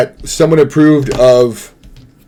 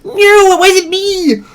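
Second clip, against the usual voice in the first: high, slow mock-comic squeal; Blackadder; much slower than usual. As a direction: stretch it out high in a comic impression. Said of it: "I sound like Blackadder".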